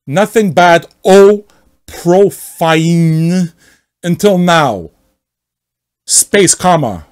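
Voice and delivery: hammed-up offended poster; angry member sneering at the rules; mock outrage. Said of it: "Let me read here".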